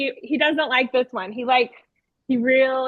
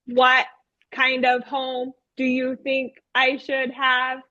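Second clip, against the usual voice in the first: slow, evenly spaced robot question; 2 semitones higher, slower than usual. Retold or imitated. Teacher as robot puppet asking children for ideas, robotic monotone. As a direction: ask in a measured robotic monotone. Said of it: "I talked like that".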